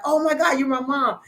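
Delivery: C=89; retold tearful cry. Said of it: "I was crying / I was like".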